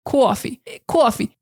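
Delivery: New York "coffee" as "cawfee"; New York accent. Shown as a demonstration. Teacher mimicking how New Yorkers say "coffee". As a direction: round the vowel in "coffee": "kwawfee". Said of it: "the way they say coffee".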